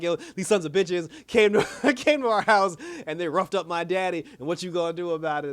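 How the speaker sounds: animated mock outrage in American slang; switch to street talk. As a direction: drop the act and demand action, amused. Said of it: "she done went from".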